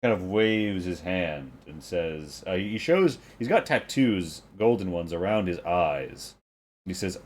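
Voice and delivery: usual speaking voice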